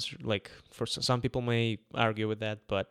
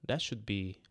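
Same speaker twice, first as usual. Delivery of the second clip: ordinary talk, nothing marked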